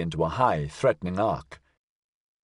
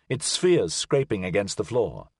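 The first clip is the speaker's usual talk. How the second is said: ordinary delivery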